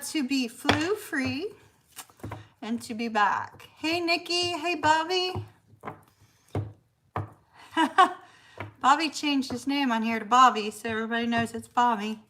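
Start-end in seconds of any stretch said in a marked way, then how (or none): none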